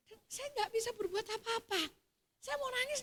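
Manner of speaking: normal talking voice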